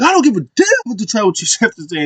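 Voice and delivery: defiant. Retold or imitated. Acted retort in retold argument.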